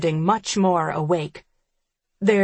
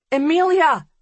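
calling out; 10 semitones higher, 4 dB louder than usual. A narrator's reading.

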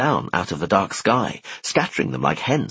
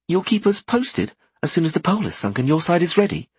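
put-on higher, brighter girl voice; young woman voice; 9 semitones higher than usual. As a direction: play Merry, lively. Merry's voice.

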